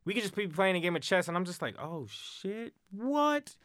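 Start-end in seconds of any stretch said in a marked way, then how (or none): 1.8-3.6 surprised. Retold or imitated. Amazed during chess game.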